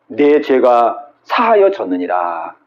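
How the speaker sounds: 낮고 느린 엄숙한 하나님 목소리; 천천히 무겁게 선언하듯; 하나님의 음성. Acted. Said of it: "대면서 하나님 뭐라고 했냐면". (낮고 느리게 하나님 목소리로)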